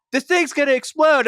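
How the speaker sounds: mock panic. A viewer watching the timer, in mock panic. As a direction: sell the fear like a nervous fan. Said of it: "and you're like".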